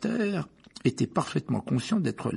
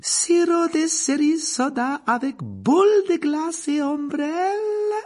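high, slow, bright-voiced barmaid; 6 dB louder, slower than usual. A narrator's reading. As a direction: lift the voice high and serve the drinks sweetly. Narrator voices barmaid Rosmerta.

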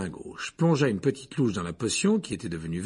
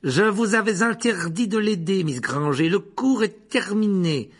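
higher and louder furious rebuke; stern scolding; 9 semitones higher, 6 dB louder, slower than usual. Acted. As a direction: snap at her and end the class. Rogue scolds Hermione. (réprimande sèche et forte)

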